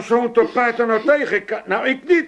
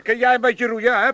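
ordinary delivery

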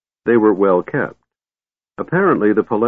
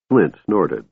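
usual speaking voice

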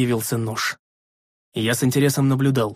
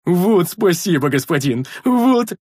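slow, higher, delighted shout; joyful thanks; 5 semitones higher, 4 dB louder, slower than usual. A narrator's reading. Big man thanking his master, overjoyed. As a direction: roar gratitude. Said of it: "обрадованно рявкнул Рикар".

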